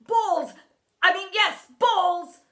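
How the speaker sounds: loud, high involuntary outbursts; flustered blurting. A narrator's reading. Teacher failing to obey headmistress, flustered blurting. Teacher cannot stop saying it.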